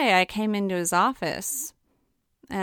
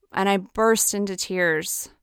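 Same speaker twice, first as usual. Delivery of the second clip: usual speaking voice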